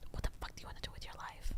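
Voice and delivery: imitating mom's angry church whisper; hissed whisper. Mom scolding her child in church, hissed whisper. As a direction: whisper it fast and cross, no voice at all.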